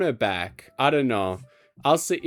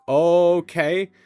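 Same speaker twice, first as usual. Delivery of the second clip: "okay" with a long, stretched first O; "okay" stretched out as if spelt with many O's; drawn-out okay; 3 semitones higher, 6 dB louder than usual. Shown as a demonstration. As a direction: hold the O of "okay" loud and long. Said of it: "with a lot of O's".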